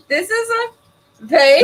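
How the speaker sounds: slow high teasing question; drawn-out incredulous rise; mock disbelief. Friend teases the obvious vase remark.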